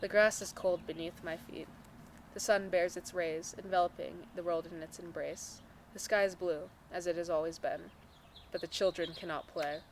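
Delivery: measured poetry recital; poem reading. Acted. Reading own poem to a friend, measured recital. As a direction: read it as verse, line by line.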